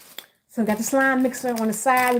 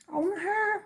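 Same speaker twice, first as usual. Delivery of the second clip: put-on high child voice listing every toy; greedy kid pleading; 10 semitones higher, 5 dB quieter than usual. Kid at the Easter egg hunt begging mommy, high whiny voice. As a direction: whine for each toy like a little kid.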